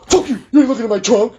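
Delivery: high-pitched mock-outraged driver; agitated refusal. Acting driver refusing a trunk search, agitated. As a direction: fire back in a jumped-up, high voice.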